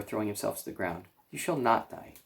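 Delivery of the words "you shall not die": ordinary delivery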